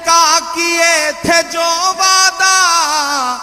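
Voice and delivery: loud, sung Urdu verse recital; stately nazm recitation. Acted. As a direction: chant each verse slowly with swelling force.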